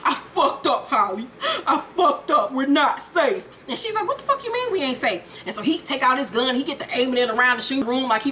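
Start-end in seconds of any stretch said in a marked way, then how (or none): 0.0-3.5 hammed-up sobbing confession; badly faked weepy wail; mock crying. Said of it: "crying his eyes out". 4.1-5.4 high-pitched mock panic; alarmed disbelief. Acted alarmed reply.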